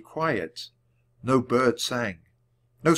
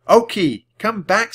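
calling; 9 semitones higher, 8 dB louder than usual. A narrator's reading. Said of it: "said Jack".

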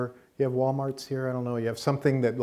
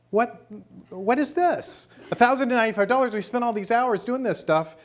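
much higher, louder, fast outraged disbelief; 11 semitones higher, 4 dB louder, faster than usual. Retold or imitated. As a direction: react in shock at a crazy price. Outraged at the brochure price.

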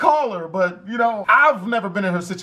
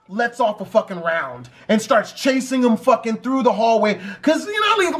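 usual speaking voice

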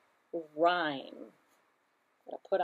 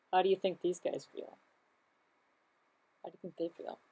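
plain reading voice

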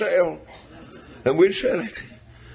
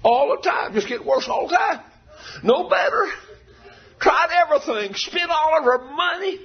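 higher preaching lines; rising preaching; 10 semitones higher than usual. Real, not acted. Preacher telling the woman's plight, higher. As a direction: lift it into a preaching cry.

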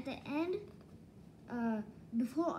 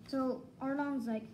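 ordinary talk, nothing marked

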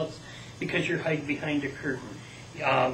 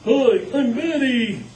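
high, loud, slow bat puppet; Betty the bat voice; 8 semitones higher, 7 dB louder, slower than usual. Shown as a demonstration. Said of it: "a bat".

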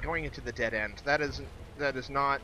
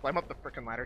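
normal talking voice

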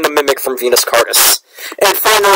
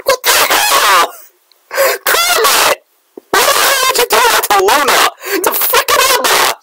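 high pitched disbelieving outcry; outraged shock. Real, not acted. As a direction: shriek it in betrayed disbelief. Shocked by a betrayal death.

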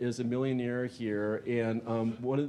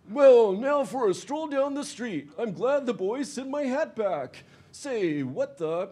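higher, louder, slow cartoon gangster; voiced mobster; 11 semitones higher, 6 dB louder than usual. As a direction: strut along as a smug crook.

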